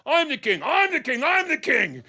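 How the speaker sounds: high-pitched, heated insistence; insistent arguing. Acted. King argues with beggar over who is king, insistent. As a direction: argue it high, refusing to give way. Two kings arguing back and forth.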